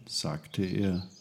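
usual speaking voice

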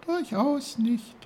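very high, tiny flower voice; reply as a dainty talking flower; squeaky-high small character; little snowdrop. A narrator's reading. Snowdrop replies.

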